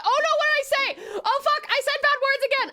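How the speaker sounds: high, laughing, embarrassed apology; flustered apology. Real, not acted. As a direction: squeal out a panicked sorry. Apologising for swearing at raider.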